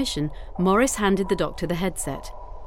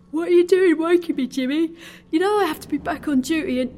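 high-pitched sleepy grumble; complaining seaman; 10 semitones higher, 4 dB louder than usual. A narrator's reading. Woken seaman to his mate, high complaint. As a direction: protest in a high voice, cut off.

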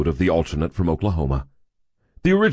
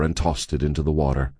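ordinary delivery, nothing marked

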